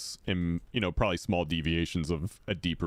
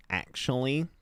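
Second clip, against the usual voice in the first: nerd voice. Shown as a demonstration. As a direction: correct them like a prim nerd. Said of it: "I do like to pull out the nerd, uh, voice".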